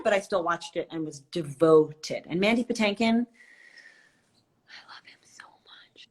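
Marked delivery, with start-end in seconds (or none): 4.7-6.1 barely-there, voiceless whisper; adoring whisper. Real, not acted. Fan gushing over [name], adoring whisper.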